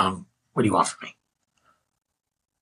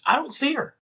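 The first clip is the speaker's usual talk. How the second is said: much higher-pitched bafflement; retold mounting alarm; 14 semitones higher than usual. Searching everywhere for the missing woman, high-pitched retold alarm. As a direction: jump up high at the end of a fruitless search. Searching in vain, list climaxes.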